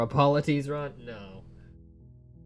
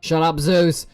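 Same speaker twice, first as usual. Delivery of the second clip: yelled, high and loud; loud shout; 5 semitones higher, 11 dB louder than usual. Real, not acted. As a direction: shout him down. Yells at the narrator.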